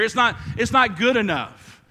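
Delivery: impassioned sermon climax; full-force preaching. Acted. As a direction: preach it with fire.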